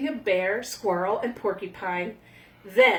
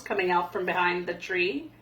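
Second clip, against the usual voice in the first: ordinary delivery, nothing marked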